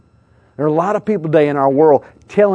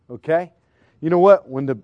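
usual speaking voice

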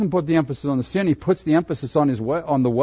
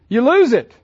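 high and loud, lose punched hard; emphatic answer; 10 semitones higher, 8 dB louder than usual. Acted. Preacher answering his own question, loud and emphatic.

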